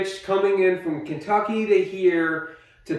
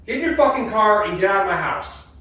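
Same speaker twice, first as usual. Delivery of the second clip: angry yelling; 4 semitones higher than usual. Real, not acted. Ordering partner out, yelling.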